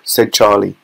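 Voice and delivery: ordinary delivery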